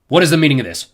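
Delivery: loud indignant demand; outraged. A narrator's reading. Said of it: "full of outrage".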